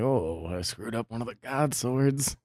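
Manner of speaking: low, soft imagined confession; lightly acted blacksmith, hushed and low; sheepish blacksmith. Blacksmith owning up to a botched sword, lightly acted sheepish. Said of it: "he's like".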